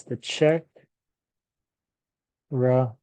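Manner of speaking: Czech said like check; pronunciation demo; careful model. Shown as a demonstration. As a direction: say The Czech Republic as it sounds. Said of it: "it sounds just like this".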